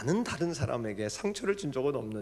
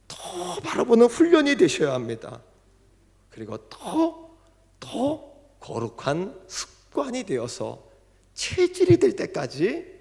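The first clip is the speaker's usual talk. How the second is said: fervent exhortation; 7 semitones higher, 6 dB louder than usual. Real, not acted. Exhortation to the congregation.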